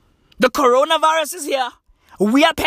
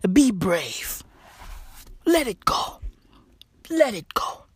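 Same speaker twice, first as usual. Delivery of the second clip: hushed whisper, softer; 2 semitones lower, 5 dB quieter than usual. Acted. Ranter whispering calm advice after yelling. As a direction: breathe it like a secret.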